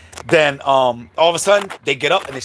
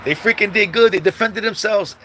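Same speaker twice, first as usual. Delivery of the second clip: impressed neighbor; 7 semitones higher than usual. Retold or imitated. Block dudes praising the brothers to the mom. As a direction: hype them up to the mom. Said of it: "So they was like".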